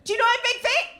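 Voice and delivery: very high, loud confrontational shout; defiant yell. Retold or imitated. Turning on the heckler, high defiant shout. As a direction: yell back in his face. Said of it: "I was like".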